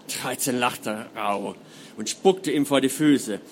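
measured, dramatic reading voice; story narration. A narrator's reading. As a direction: read it like an audiobook. Author reads novel prologue aloud.

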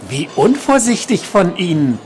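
high-pitched voice of a man in the shadows; a creepy reproach, pitched high without real hush; softly menacing stalker. A narrator's reading. Man ambushing a nurse in the dark, high creepy voice. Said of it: "sagte eine leise Stimme".

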